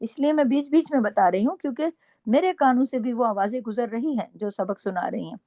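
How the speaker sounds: ordinary talk, nothing marked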